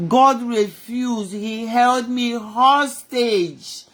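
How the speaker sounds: very slow, every word weighed; slow emphatic. Real, not acted. Preacher telling the congregation God held her, slow. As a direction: let each word land slowly.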